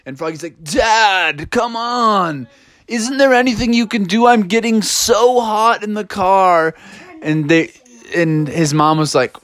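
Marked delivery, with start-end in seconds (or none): 0.6-6.8 put-on high, drawn-out kid complaint; whining Froggy. Froggy whines at dad.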